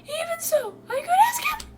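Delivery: very high, hammed-up falsetto; squeaky put-on anguish; overwrought servant voice. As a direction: wail it like a devoted attendant. Reads Phil's lines in voice.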